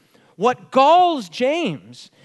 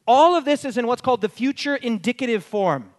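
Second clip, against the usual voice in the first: usual speaking voice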